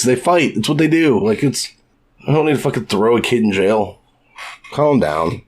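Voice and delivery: ordinary talk, nothing marked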